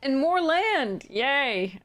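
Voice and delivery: slow, high mock celebration; sarcastic cheer. Real, not acted. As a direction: fake delight at bad luck. Drawing yet another land.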